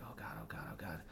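whispered, breathless "oh God" to himself; a hushed, frightened whisper; panicked whisper. Retold or imitated. Shaking alone in the car, panicking.

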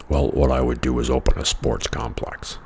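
a hint of a dull know-it-all; annoying guy voiced.

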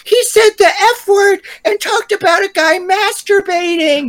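very high, slow, starry-eyed fanboy voice; squeaky high awe, dragged out slowly; mocking edgy boy. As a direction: parody a dazzled teen who just read his first edgy novel. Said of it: "edgy little boys who read their first edgy little novel and be like".

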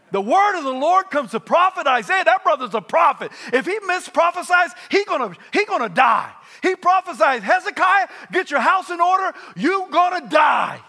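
loud, high, shouted preaching; full-force preaching. Acted. Preacher shouting the prophet's warning to the congregation. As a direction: thunder it out to the congregation.

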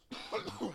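sharp real cough; a single rough cough; coughing fit. Real, not acted. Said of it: "Excuse me".

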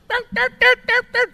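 high sing-song phone ring; high doot doot. Retold or imitated. Imitating a phone ringing for his co-host.

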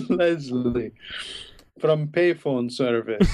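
slow mock Russian accent; Russian-accented phone caller; Russian mobster. Caller claiming to be payphone service, Russian mobster accent. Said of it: "Russian mobster character".

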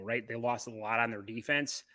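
ordinary talk, nothing marked

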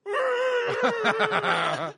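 long, high, loud mock scream; body's terrified scream. Man acting out his sleeping body's panic, long scream. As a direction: let out a drawn-out nightmare shriek. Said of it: "my body's going".

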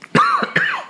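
a single muffled cough; real cough. Real, not acted. Sick speaker finally coughs into his arm. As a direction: cough once into your arm.

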